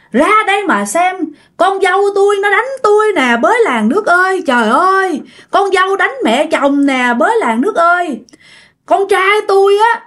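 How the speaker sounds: high-pitched, hammed-up cry to the whole village; wailing for help. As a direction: call the neighbours out, playing the wronged victim. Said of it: "bả la, bả gào, bả ăn vạ".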